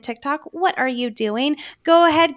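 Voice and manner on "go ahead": high, loud push; loud urging. Real, not acted. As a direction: call it out to listeners. Urging listeners loudly.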